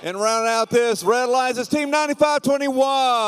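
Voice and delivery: high, hyped-up arena announcer. Acted. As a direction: pump up the crowd announcing teams. Hypes the match line-up.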